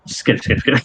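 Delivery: soft, voiceless skittering sound; creature skittering noise. Acted. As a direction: imitate little scuttling feet in a whisper. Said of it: "And it just goes".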